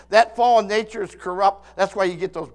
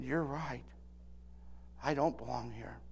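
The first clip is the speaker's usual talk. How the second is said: much softer, tearful whisper of a reply; choked-up admission; 8 semitones lower, 16 dB quieter than usual. Retold or imitated. Preacher tearfully admits to the bartender he doesn't belong, broken and soft. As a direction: barely get it out through tears. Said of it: "I started to cry".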